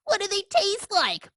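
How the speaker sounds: mock his question; mocking imitation. Pigeon mocks duckling.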